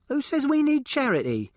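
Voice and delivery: put-on high, indignant posh girl's voice; offended young lady. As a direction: bristle at the word charity, pitched high. Offended girl voice.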